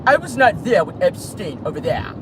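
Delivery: lightly put-on British accent, denying everything; hint of a posh British denial. Vlogger mocking Prince Andrew in a British accent. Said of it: "can I do it in their accent?".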